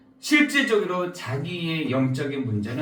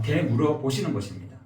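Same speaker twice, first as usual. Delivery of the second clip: ordinary delivery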